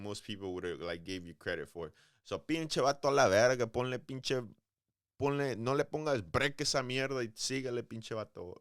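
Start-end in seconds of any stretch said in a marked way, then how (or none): 2.2-8.6 put-on Mexican Spanish accent, a touch higher and louder. Urging the Mexican boxer on, in a Mexican Spanish accent. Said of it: "That's my Mexican accent for him".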